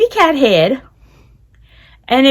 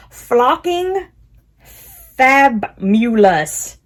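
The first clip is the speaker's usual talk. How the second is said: careful slow; much slower than usual. Shown as a demonstration. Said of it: "I'm trying to say it without tripping up".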